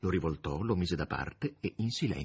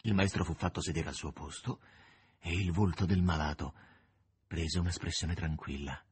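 ordinary delivery

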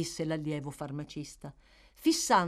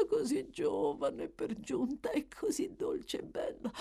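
higher, crying whisper; tearful whisper; 10 semitones higher, 3 dB quieter than usual. Acted. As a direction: weep the words softly. Dying woman grieving the girl.

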